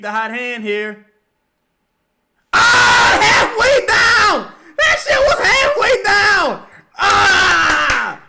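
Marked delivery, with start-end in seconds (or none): 2.5-8.2 high, loud, slow cry of agony; anguished scream. Real, not acted. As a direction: wail over the shot that rimmed out. Shot rims out.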